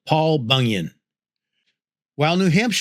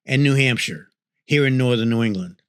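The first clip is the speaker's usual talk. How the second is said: ordinary delivery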